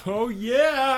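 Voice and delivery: high, loud hype; hyped oh yeah for the tweet. Real, not acted. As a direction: pump it up like a crowd. Hyping the hashtag.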